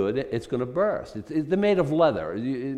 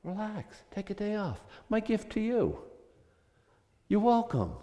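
higher, much softer, kindly offering rest; gentle God; 5 semitones higher, 6 dB quieter than usual. Retold or imitated. God gently tells freed slaves to rest, soft. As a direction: offer it softly like a gift. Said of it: "this is what God meant".

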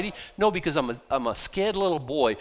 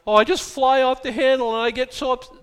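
higher, louder put-on complaint; mock excuse-making; 7 semitones higher, 5 dB louder than usual. Preacher mimics someone excusing their anger, mock excuse-making. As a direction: make the hot-tempered excuse in a raised voice. Mocking an excuse-maker's words.

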